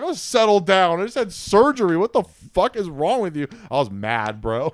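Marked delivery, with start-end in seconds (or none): 0.0-3.5 high, loud, mocking snap back; angry yell. Patient mocking the nurse's settle down, high and loud. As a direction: throw her words back at her, furious. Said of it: "I was like".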